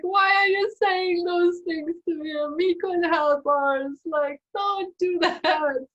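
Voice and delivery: high, loud mock-desperate whine; squeaky protest easing down slightly; little ego protesting. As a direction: plead in a hammed-up panic, half laughing. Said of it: "And my little ego".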